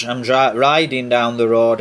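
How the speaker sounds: ordinary talk, nothing marked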